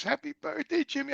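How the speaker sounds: high sweet voice of the mother-in-law. Retold or imitated. Mother-in-law handing him a gift box, high and sweet. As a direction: chirp it like a sweet old lady.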